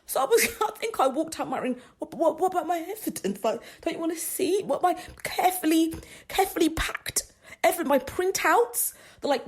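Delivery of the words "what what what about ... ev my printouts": high, laughing mock indignation; laughing protest. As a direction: whine higher about the printouts. Retelling deflated protest.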